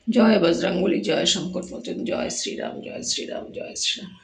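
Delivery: softly murmured devotional chant, fading to a whisper; low devotional murmur of praise; hushed prayer. Real, not acted. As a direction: pray quietly before the deity, sinking into whispers. Prayer at home shrine.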